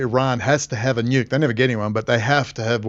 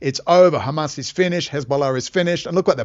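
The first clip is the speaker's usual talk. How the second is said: ordinary talk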